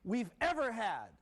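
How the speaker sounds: high, thrilled welcome back to the show; excited. Real, not acted. Host greets the audience back on air after the snow, excited. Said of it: "we're so excited here".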